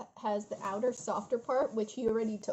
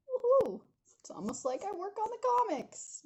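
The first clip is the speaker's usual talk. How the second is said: playful self-cheer; 10 semitones higher than usual. Real, not acted. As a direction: celebrate yourself in a high, jokey voice. Jokingly celebrating own drawing skill.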